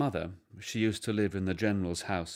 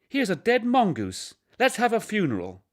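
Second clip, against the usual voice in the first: put-on much higher, louder eager little boy; bright childish call, much higher and louder; small boy; 12 semitones higher, 6 dB louder than usual. A narrator's reading. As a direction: play the excited child. Small boy voiced in story.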